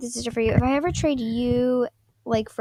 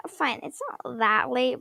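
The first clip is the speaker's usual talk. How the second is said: ordinary delivery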